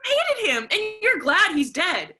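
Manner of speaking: furious high yell; shrieking accusation. Acted. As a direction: scream the accusation at her mother. Daughter repeats accusation.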